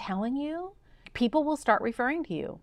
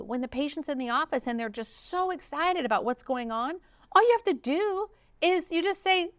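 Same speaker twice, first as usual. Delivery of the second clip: ordinary talk, nothing marked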